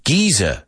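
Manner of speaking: "Geyser" with a long ee and no final r; British pronunciation. Retold or imitated. Teacher echoing Google's British audio, British pronunciation. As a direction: echo it British-style, "Geyser" as GEE-zuh. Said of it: "I just got the British pronunciation".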